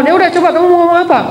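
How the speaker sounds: quick, haughty go-ahead; dismissive Pharaoh. Acted. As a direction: brush him off like a bored king. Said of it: "Fir'aun sombong".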